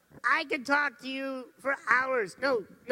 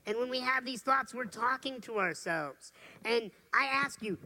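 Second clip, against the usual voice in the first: ordinary talk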